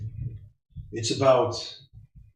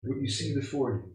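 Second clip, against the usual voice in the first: game master narration; 6 dB quieter than usual. Acted. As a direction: paint the scene softly. GM scene narration.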